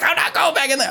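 high-pitched imitation of scared refusal; panicked refusal. Scared girl refuses to go back downstairs, panicked.

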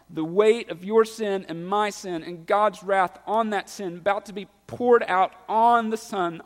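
voicing Jesus's anguished plea from the pulpit; full-force preaching.

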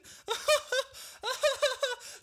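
high, loud mocking cackle; mocking laugh. Ticket clerks laughing at the late traveller, mocking cackle. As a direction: laugh at him like a fool. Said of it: "die lachen alle so".

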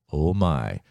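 usual speaking voice

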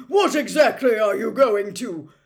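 very loud outraged demand; angry full-volume shout; bellowing. A narrator's reading. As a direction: roar the question. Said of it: "he bellowed at the fire".

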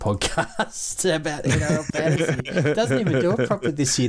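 giggling through the jab at a rival; laughing while talking. Real, not acted. Mocking the ex-podcaster who won the league, laughing.

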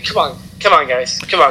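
a low, hint of Roman's rally call; mock him pleading with the crowd, low; lightly voiced Roman. Co-host imitates Roman Reigns urging the crowd, low mock plea. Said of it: "Roman saying".

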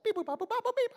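high electronic beeps; quick high-pitched beep-boop run; imitated dial tones. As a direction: chirp out a phone being dialled.

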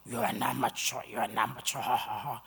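very low guttural taunt; guttural demon. Retold or imitated. Possessed woman taunting the preacher in a changed voice, guttural. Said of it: "no, guttural".

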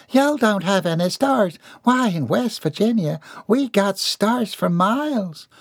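high, folksy drawl, stretching the last words; country mother, boastful. A narrator's reading. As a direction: brag about home like a country woman.

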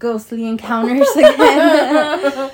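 laughing through the words; slow and loud with a laugh. Real, not acted. Laughing at repeated topic.

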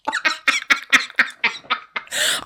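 airy unvoiced giggle; breathy laugh. Real, not acted. Describing the table roast, airy breathless giggle. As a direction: laugh silently through the nose.

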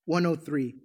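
usual speaking voice

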